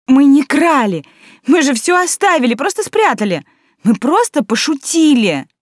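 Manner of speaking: high, loud panicky denial; high pleading protest. A narrator's reading. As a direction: plead your innocence in a panic. Caught girl pleads innocence.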